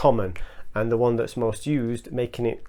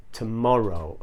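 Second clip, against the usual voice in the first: tomorrow slow, mo clearly stressed; teacher gives correct tomorrow, slow, stress on MO; 2 semitones lower than usual. Shown as a demonstration. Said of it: "it's tomorrow".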